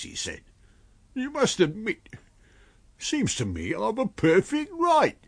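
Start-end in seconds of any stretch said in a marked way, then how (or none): 1.1-5.2 high-pitched, aggrieved tramp voice; protesting tramp. Acted. Tramp claiming his rights to his master, high-pitched protest. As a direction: argue your case in a high character voice.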